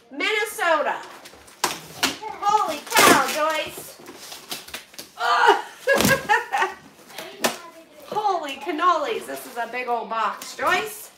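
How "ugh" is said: loud high grunt breaking into laughter; heave, then laugh at yourself; effortful groan, laughing. Real, not acted. Struggling with a huge box.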